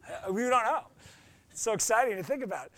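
ordinary talk, nothing marked

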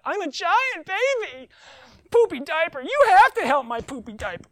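put-on whiny giant baby. Preacher mocks lazy Christians as a wailing baby. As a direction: bawl like a toddler wanting a diaper change.

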